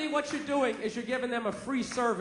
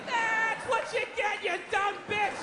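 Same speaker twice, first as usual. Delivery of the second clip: higher, slow gloating yell; screaming at the screen; 7 semitones higher, slower than usual. Retold or imitated. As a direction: gloat at the snake video. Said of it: "Just screaming at my computer".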